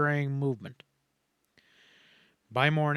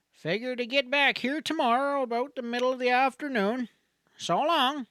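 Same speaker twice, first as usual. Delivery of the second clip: higher-pitched voice of a folksy country doctor; kindly doctor's goodbye; 11 semitones higher than usual. A narrator's reading. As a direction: send her off like a fatherly frontier doctor. Doctor says goodbye to Helen.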